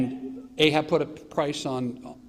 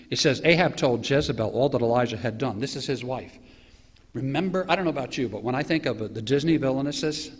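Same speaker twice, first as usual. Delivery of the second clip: ordinary delivery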